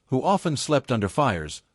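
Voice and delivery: ordinary delivery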